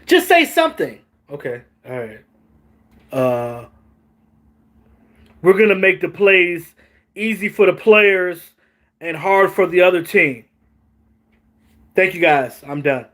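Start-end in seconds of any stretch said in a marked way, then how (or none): 1.3-13.1 mock clueless coach. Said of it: "That's basically what he went up there and said".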